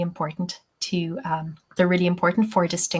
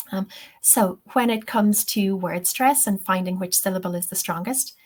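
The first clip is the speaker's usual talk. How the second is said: normal talking voice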